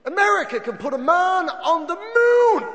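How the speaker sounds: mock grandeur.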